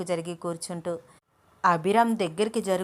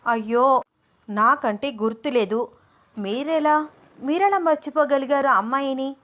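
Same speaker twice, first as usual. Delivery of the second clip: higher, indignant and accusing; raised, reproachful complaint; angry accusation; 6 semitones higher, 3 dB louder than usual. Acted. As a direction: confront him with hurt anger. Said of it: "కోపంగా చూస్తూ".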